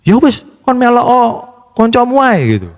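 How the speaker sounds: high-pitched, huffy retort in Javanese; mock angry parent. Preacher mimicking parents angry at being compared, mock indignant. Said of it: "Marah pasti toh".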